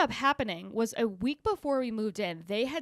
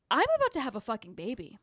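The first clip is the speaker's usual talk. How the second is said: exasperated retort; much faster than usual. Retold or imitated. Retorting to the project manager.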